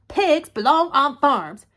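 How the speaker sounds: loud, slow, high pronouncement; emphatic declaration. Acted. Eugenia declares her opinion about pigs to Baby, emphatic. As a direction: lay down the rule, word by word.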